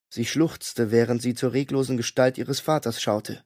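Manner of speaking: usual speaking voice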